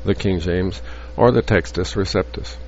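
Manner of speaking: ordinary talk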